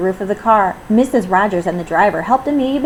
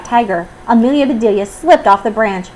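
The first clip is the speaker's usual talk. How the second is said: plain reading voice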